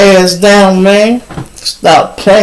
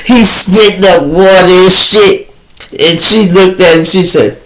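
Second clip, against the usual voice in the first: ordinary delivery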